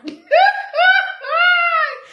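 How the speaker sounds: high shrieking laugh; loud laugh. Real, not acted. Laughing at the belly joke to viewers. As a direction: burst out laughing.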